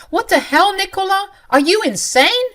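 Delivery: high, loud outraged shouting; angry yell. Acted. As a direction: scream it at her in disbelief. Husband yells at burning room.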